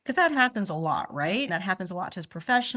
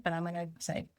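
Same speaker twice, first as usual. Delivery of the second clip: ordinary delivery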